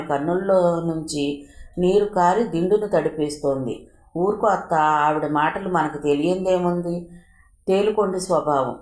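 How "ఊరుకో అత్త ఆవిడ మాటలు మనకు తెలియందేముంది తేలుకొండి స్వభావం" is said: plain reading voice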